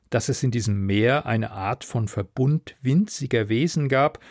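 ordinary delivery

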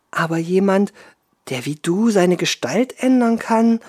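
water spirit voice. Acted. Water woman voiced in fairy tale.

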